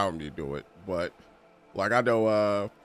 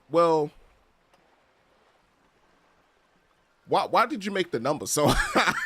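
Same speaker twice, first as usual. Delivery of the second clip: loud, high, laughing disbelief; baffled question; 10 semitones higher, 8 dB louder than usual. Retold or imitated. Teen in a Jamaican store, baffled by prices, laughing. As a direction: blurt it out, amazed and laughing.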